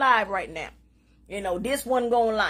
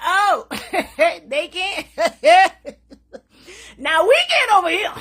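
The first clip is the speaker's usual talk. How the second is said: louder, high, through laughter; laughing gleefully; 5 semitones higher, 6 dB louder than usual. Real, not acted. Taunting the rivals to her viewers, laughing loud. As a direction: gloat while laughing.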